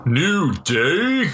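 a high, punchy catchphrase call; hype call. Pretend. Cohost asked to finish the chant, hyped call. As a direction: shout the team name like a fan. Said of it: "can you say it for me".

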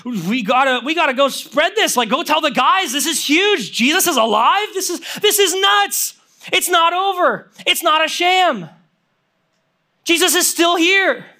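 building to high, urgent elation; acted-out jubilant urgency, climbing in pitch; antsy excitement. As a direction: burst out with news you can't hold in. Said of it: "there's an antsiness in you".

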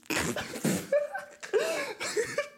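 high laugh. Real, not acted. Laughs at own insult.

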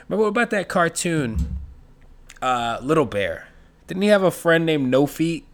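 ordinary talk

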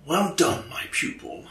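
soft, fading whisper in the head; mentor's voice in the wizard's head; ghostly whisper. Acted. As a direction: breathe it like a voice from inside the mind. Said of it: "you hear a familiar voice in the back of your head".

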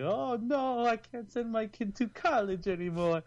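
high, put-on Italian accent. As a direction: play a worried Italian dad. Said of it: "I don't know why he's Italian".

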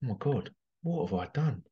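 soft, stunned self-reproach; hushed dismay. Retold or imitated. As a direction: mutter it in quiet shock. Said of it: "may have thought".

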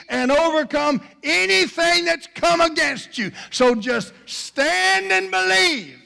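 high, loud preaching at full force; rousing, sustained high-pitched exhortation; triumphant declaration. Acted. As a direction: proclaim victory, building to a shouted climax. Faith declares victory, building to climax.

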